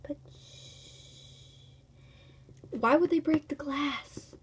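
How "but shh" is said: whispered shush. Acted. Hushing near the creatures.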